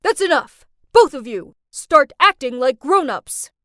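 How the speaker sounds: very loud, commanding reprimand; angry shouting. Acted. Dad breaking up mother-daughter fight, angry shout. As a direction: put a stop to it with a shout. Dad slams table, halts the quarrel.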